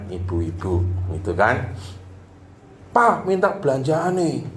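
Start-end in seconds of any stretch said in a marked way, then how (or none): 2.9-4.5 a lightly voiced wife asking for shopping money; hint of a wife's coaxing. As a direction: ask sweetly for money.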